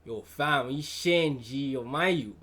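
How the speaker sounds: slow, forced Toronto slang. Retold or imitated.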